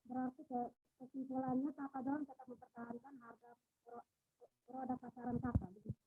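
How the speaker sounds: faint, far from the mic; speaking much too softly to hear; barely audible. Real, not acted. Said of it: "suaranya terlalu kecil, tidak dengar".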